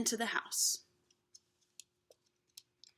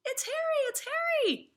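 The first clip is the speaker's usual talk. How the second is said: put-on excited children shouting. Children call their parents, high and thrilled. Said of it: "they began shouting".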